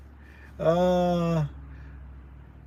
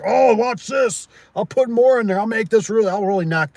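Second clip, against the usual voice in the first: mock cocky; 8 semitones higher, 4 dB louder than usual. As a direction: ham up a smug "watch this" bragger. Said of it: "And then you're like".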